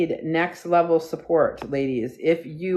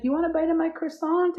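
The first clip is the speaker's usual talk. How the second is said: much higher, sing-song offer to a puppy; baby talk to dog; 12 semitones higher than usual. Retold or imitated. Offering her puppy a croissant bite, high cooing voice. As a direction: coo it like tempting a pet with a treat. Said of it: "And I'm like".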